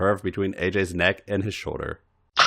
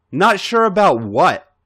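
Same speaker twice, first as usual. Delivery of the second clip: lightly voiced Alex, a little louder; teasing question; 9 semitones higher, 6 dB louder than usual. A narrator's reading. Alex teasing A J on the bed, mock innocent. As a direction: toss the question back playfully. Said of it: "Alex asked".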